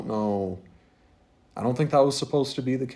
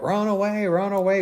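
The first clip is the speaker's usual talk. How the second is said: higher-pitched retold warning; mock warning call; 9 semitones higher than usual. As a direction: imitate calling friends to flee. Acted warning to fleeing friends.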